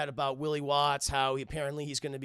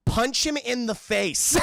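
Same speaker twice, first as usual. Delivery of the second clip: loud, slow, higher shout; shouted order; 7 semitones higher, 7 dB louder, slower than usual. Retold or imitated. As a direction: bark it into his ear. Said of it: "Bruce is just saying".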